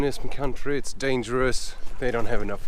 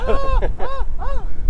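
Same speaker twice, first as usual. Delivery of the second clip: laughing very high and giddy; real delighted laughing; high joyful laughter; 3 dB louder than usual. Real, not acted. Cyclist at the Greek border, laughing high with joy.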